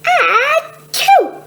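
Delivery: big high sneeze; a sudden theatrical sneeze; loud sneeze. Acted. Reading a picture book, the sneezy sister sneezes. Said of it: "Wanda one is always sneezing".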